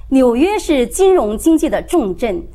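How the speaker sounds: ordinary delivery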